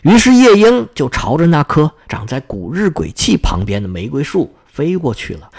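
usual speaking voice

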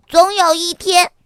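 loud, high little-fox voice; loud declaration. A narrator's reading. Little fox declaring a big plan to mama, loudly. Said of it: "小狐狸大声说".

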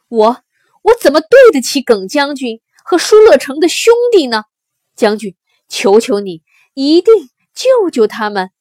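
loud, desperate begging; impassioned, loud appeal for his comrades; agitated tearful plea. A narrator's reading. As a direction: implore the general with everything you have. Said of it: "他激动地流下了眼泪".

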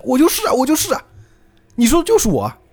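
high, urgent protest; it's me, it's me; desperate insisting. Retold or imitated. Bobo insisting to Jotaro that he is the boy, high and urgent.

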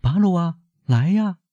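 summoning call, pitched well up. A narrator's reading. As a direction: call the servant from across the house. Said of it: "瓦朗蒂娜叫道".

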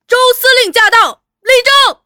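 high, very loud military command; thunderous stern shout. A narrator's reading. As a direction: bellow the announcement like a thunderclap. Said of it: "以霹雷巨响厉声喝道". (厉声大喝，如霹雳巨响)

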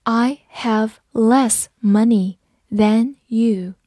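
each word set apart; unhurried teacher's model, word by word; slow read-through. Shown as a demonstration. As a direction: say it slowly with pauses between words. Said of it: "好，慢读一遍".

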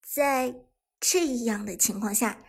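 plain reading voice

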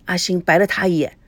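plain reading voice

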